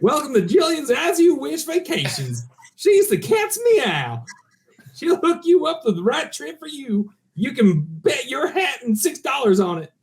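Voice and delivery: put-on old-timey ad read. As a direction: sell it like a 1920s radio ad. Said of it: "Do it again".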